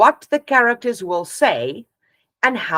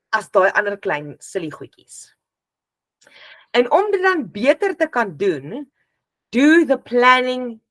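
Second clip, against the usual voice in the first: ordinary delivery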